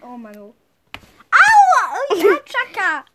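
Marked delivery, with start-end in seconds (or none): 1.3-1.9 high, loud mock yelp; pain cry. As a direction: yelp as if hurt.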